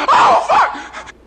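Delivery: sudden high, loud yelp of pain; real pained shout. Real, not acted. Stung by onion juice, she cries out in pain. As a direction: cry out as the sting hits.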